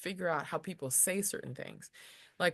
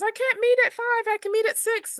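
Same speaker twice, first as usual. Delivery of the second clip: high mock voice; 9 dB louder than usual. Quoting the person canceling.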